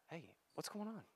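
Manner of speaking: soft gentle ask. Retold or imitated. Gentle question to upset wife.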